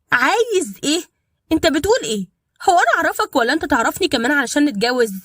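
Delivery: shocked. A narrator's reading. Dalida shocked by proposal.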